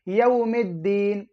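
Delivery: slow यौमिद्दीन with "mid", not "bid"; careful model of यौमिद्दीन, long final vowel; clear m sound. Shown as a demonstration. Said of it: "मीम है मीम".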